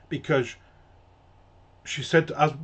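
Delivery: ordinary delivery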